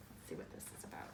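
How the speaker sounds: soft whispered aside; hushed aside. Real, not acted. Telling the child softly what comes next. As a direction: lean in and whisper it.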